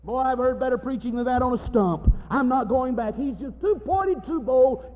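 high, loud grumbling churchgoer; mock indignant complaint. Church lady griping about last night's preacher, mock indignation. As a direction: complain loudly, hamming up the outrage.

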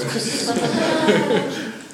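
high bursting laugh; burst of laughter. Real, not acted. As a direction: let out a big laugh. Laughing at own story.

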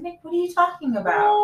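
incredulous husband. Retold or imitated. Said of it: "he's like".

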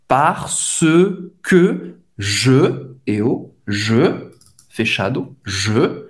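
"je" low and slow, lips rounded as for O; rounded E drill. Shown as a demonstration. Teacher drills "je" sound for students, lips rounded. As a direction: shape O with the lips and say E on "je". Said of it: "fazer a boca do O e falar E".

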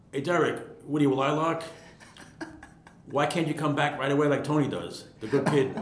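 lightly acted reprimand from the boss; mock scolding. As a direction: chide the kid in a retold boss voice. Boss's scolding acted in retelling.

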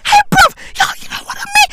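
squealing mock shout. Pleading with viewers in hammed-up hysterics, squealing shout.

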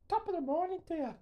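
high, fast, mock Irish lilt; a quick stab at an Irish accent. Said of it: "I can't do accents".

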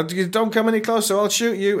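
high-pitched, stammering mock threat; imitating a nervous standoff; mock alarmed warning. Soldiers warning the knife-wielding mate, mock alarm.

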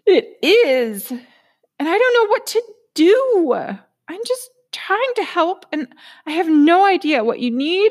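high, loud, overwhelmed fretting voice; a frantic overworked inner self pouring it all out; Miss Busybody. Acted. Inner voice answers again.

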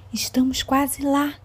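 usual speaking voice

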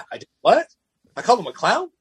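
high, incredulous double-take; stunned disbelief. Retold or imitated. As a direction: act like you can't believe the charge. Retold disbelief at accusation.

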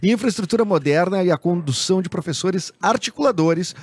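upbeat radio ad read. Acted. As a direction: read it like a sponsor spot. Reads sponsored ad.

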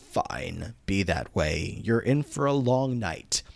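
low villain voice, slowing at the end; hammed-up threat, deep and deliberate; evil distorted villain. Sue threatening the boys with a long night, evil villain voice. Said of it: "in this very evil, distorted voice".

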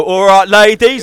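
loud, drawn-out call to the ladies; mock catcall. Friend showing how he catcalls cows, loud mock catcall. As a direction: holler it slowly like a cheesy catcaller. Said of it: "We catcall cows. I go".

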